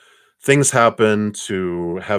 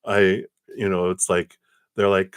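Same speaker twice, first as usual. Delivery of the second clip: ordinary talk, nothing marked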